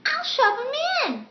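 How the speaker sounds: very high, loud character voice; hammed-up high-pitched voice; Abby. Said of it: "Abby's voice".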